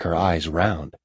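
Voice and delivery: ordinary talk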